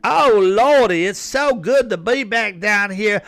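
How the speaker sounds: hammed-up Southern accent, high, loud and slow on Oh Lordy; mock Southern drawl. Comic mocking a politician pandering in the South, hammed-up Southern accent. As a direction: overdo a fake Southern accent. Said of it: "She's like".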